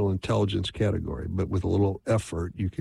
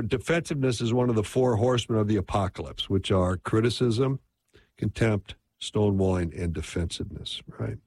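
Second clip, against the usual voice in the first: ordinary talk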